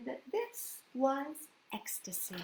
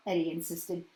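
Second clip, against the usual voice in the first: plain reading voice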